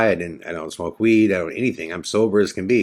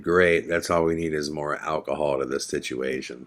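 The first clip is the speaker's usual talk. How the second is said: sarcastic aside; 5 semitones lower, 6 dB quieter than usual. Retold or imitated. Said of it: "And I'm like".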